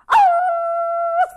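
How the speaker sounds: high, loud mocking Oh; cocky Coyote. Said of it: "stepped up and said".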